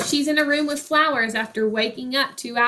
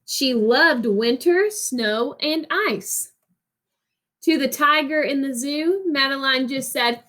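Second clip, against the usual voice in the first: put-on reading a picture book to children, rhythmic and lilting; expressive read-aloud narration; story reading. Teacher reads picture book aloud.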